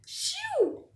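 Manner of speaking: a big high pretend sneeze; sneeze sound. Gerald sneezing at Piggy, a big high sneeze. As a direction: shoo it out like a huge sneeze.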